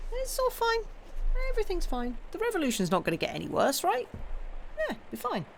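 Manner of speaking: high, fast, nervously ironic; sarcastic reassurance. Pretend. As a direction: chirp it like denial. Ironic reassurance about the revolution.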